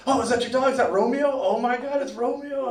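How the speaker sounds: high, excited cooing over a dog; high delighted coo at a passing dog; gushing at the neighbor's dog. Retold or imitated. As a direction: gush like a friendly neighbor. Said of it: "I'd be like".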